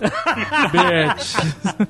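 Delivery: drawn-out lazy beach with a laugh; slow lazy bitch. Pretend. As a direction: stretch "Bitch" lazily, laughing. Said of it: "tu fica mais lento". (beach arrastado e preguiçoso)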